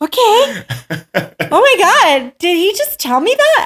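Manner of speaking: high-pitched, scandalized disbelief; shocked reaction. Retold or imitated. Woman reacting to trainer's blunt scolding, shocked. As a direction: reenact being stunned, voice shooting up.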